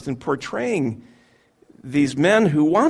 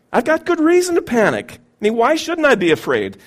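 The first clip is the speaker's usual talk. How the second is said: desperate cry; 7 semitones higher, 4 dB louder than usual. Acted. Believer's plea rises.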